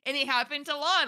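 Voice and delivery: ordinary talk, nothing marked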